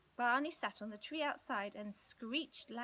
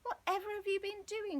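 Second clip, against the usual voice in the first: astonished mother; 10 semitones higher, 4 dB louder than usual. A narrator's reading. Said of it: "Mother was astonished".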